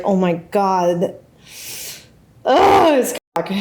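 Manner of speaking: mock ecstatic moaning, stretched out; enthusiastic pleasure. Shown as a demonstration. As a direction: gush through a hammed-up moan. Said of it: "She's just enthusiastic about it".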